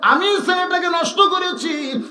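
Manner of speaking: high, chanted mock wail; acted crying. Mother takes blame for her son before father, wailing. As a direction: plead in a rising tearful singsong. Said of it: "মা কান্দের বলে".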